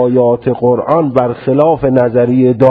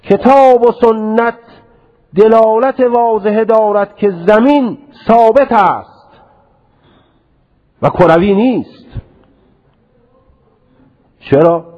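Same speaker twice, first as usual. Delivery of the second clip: put-on old scholar's verdict; 9 semitones higher than usual. Lecturer mimicking the author of Hada'iq to students, mock-emphatic. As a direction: lay down the ruling like a stubborn old scholar. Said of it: "میاد میگه".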